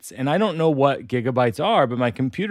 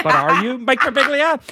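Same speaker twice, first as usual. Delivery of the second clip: high, put-on challenging question; doubting computer; 11 semitones higher, 4 dB louder than usual. Computer questioning the owner's identity, challenging. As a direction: needle him with mock suspicion. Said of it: "the computer says".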